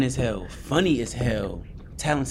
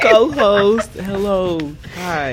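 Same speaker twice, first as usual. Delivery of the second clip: much higher and louder greeting; excited hello; 10 semitones higher, 9 dB louder, much slower than usual. Real, not acted. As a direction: burst in with eager hellos. Co-host greets audience excitedly.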